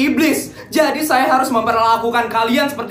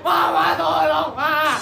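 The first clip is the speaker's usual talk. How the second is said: whiny plea to mama; 5 semitones higher, much slower than usual. Acted. Spoiled kids calling for mama.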